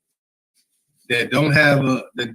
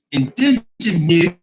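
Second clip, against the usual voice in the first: put-on New York accent; 3 semitones higher, 4 dB louder, slower than usual. Imitating chatter Rob Horn's New York accent, stretched out. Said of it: "that New York accent".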